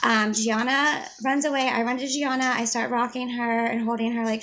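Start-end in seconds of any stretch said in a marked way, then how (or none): none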